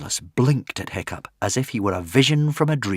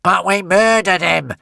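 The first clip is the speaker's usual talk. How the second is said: higher, louder outburst; stormed; 8 semitones higher, 5 dB louder than usual. Acted. Alvin raging at the witch, angry outburst. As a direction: storm at her. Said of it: "stormed Alvin".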